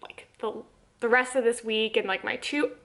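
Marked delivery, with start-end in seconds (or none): none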